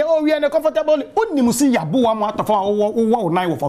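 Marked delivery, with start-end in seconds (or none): 0.0-1.1 pitched high, mocking the rivals' boast; high mock of a complacent rival; mocking quote. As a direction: sneer it like a smug boaster. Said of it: "obi si deɛ".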